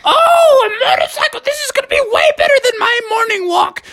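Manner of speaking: high, excited gasp of delight; gleeful Froggy voice; thrilled old frog. Acted. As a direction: marvel at a wonderful present.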